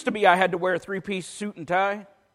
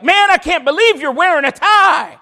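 higher, louder, hammed-up scolding shout; mock indignant colleague shouting in disbelief; mock outrage; 9 semitones higher, 11 dB louder than usual. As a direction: shout it like a scandalized minister. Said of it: "ministers are like".

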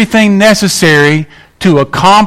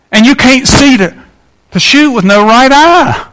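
rising preacher emphasis; 8 semitones higher, 3 dB louder than usual. Real, not acted. Preacher drives home his point.

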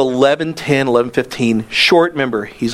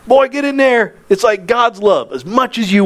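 excited urging; 9 semitones higher than usual. Retold or imitated. Housing director urging kid.

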